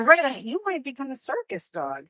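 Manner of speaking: pet talk. Real, not acted. Owner praising her dog Loretta in training, high and soft.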